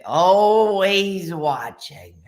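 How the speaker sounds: slow, drawn-out cartoon warning; slow, put-on monster lady voice; Roz from Monsters, Inc. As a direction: stretch it out like a watchful monster lady. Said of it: "the lady from Monsters".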